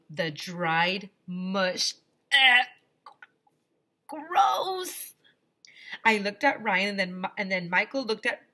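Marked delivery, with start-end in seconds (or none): 2.3-5.1 loud high disgusted groan; disgusted cry. Acted. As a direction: recoil in disgust. Disgust watching Ryan eat.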